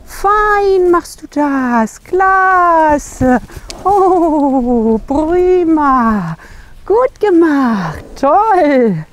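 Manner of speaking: lavish the horse with slow, glowing praise; praising a horse. Real, not acted. Praising horse circling the scary object.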